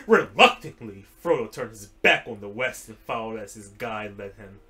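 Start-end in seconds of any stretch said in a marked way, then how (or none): none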